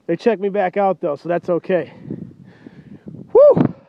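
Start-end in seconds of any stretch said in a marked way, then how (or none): 3.3-3.8 excited whoop. Real, not acted. Hyping the truck on camera.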